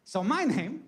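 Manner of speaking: put-on high, boastful accented proclamation; hammed-up delight in a put-on accent; Hippocrates. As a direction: brag grandly as a comic ancient physician. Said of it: "Hippocrates out".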